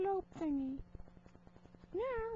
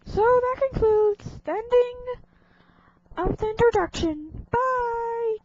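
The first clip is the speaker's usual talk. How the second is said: loud sign-off; 15 dB louder than usual. Pretend.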